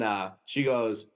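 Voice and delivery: normal talking voice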